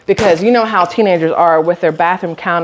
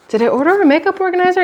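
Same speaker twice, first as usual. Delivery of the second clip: surprised; 10 semitones higher, faster than usual. Real, not acted. Confused by unexpected package.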